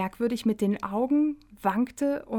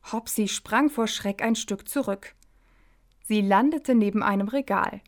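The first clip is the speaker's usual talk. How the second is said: ordinary delivery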